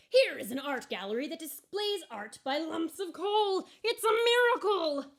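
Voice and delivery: high, loud, building to a squeal on miracle; excited cry. A narrator's reading. Lump of coal overjoyed at an art gallery, excited shout. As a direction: shout the discovery with joy. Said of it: "cried the lump of coal".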